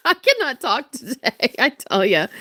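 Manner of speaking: real laugh spilling into the words; laughing at own slip. Real, not acted. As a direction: laugh at yourself for fumbling a word.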